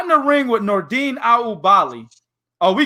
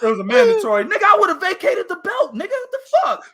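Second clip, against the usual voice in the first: mock outrage; 12 semitones higher than usual. Continuing fighter's rant.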